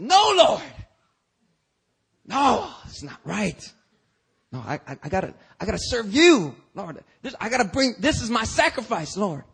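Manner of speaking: high, loud "No, Lord" then pleading; protesting Peter. Retold or imitated. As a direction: Peter refusing in earnest.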